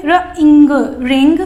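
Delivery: put-on teacher blending sounds for children. Shown as a demonstration. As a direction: blend र plus इंग into रिंग. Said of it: "तो कैसे पढ़ेंगे?".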